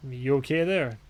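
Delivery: ordinary talk, nothing marked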